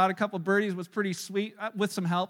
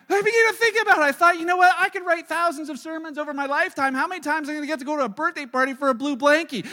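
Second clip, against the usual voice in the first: excited realization; 6 semitones higher, 6 dB louder, faster than usual. Real, not acted. Excited realisation told loudly.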